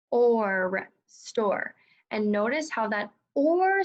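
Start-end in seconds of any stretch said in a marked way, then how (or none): 0.1-0.9 "Or" held long after separate s and t; tapped-out sounds. Shown as a demonstration. Teacher tapping one more time, "Or" drawn out. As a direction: sound out "Or" slowly on its own. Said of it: "One more time".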